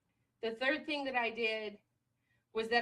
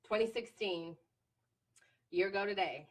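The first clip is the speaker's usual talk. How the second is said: ordinary delivery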